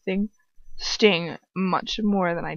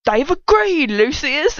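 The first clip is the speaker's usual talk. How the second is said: gloating; 7 semitones higher, 4 dB louder, slower than usual. Acted. Bellatrix gloating.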